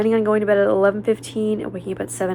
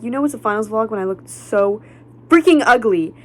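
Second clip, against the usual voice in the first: quoting herself louder, punching freaking ugly; loud retold quote; 2 semitones higher, 9 dB louder than usual. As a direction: belt out the old vlog line. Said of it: "I was like".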